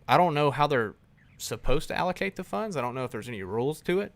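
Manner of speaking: ordinary talk, nothing marked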